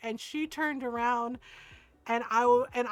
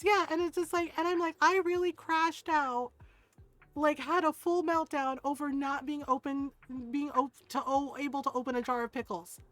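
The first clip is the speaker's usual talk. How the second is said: normal talking voice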